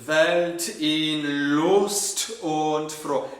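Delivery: Lust with the ST held through the whole second half of the note; long ST. Shown as a demonstration. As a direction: stretch the S T of Lust very slowly. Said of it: "Think of the S T as being this".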